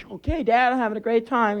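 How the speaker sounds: high whiny fake cheer; mock enthusiasm, miserable; sarcastic kid.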